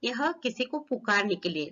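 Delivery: ordinary talk, nothing marked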